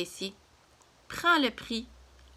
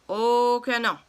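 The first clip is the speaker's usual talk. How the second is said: a louder, punched "Oh que non"; louder refusal; 2 semitones higher, 6 dB louder, slower than usual. Acted. Papa escalating the quarrel with the cashier: louder stubborn refusal. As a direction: dig in and raise your voice.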